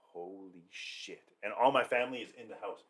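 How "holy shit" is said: very slow, hushed, low disbelief; stunned dread. Retold or imitated. Storyteller seeing the gas tank expand, stunned. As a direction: stretch it out under your breath, imitating shock.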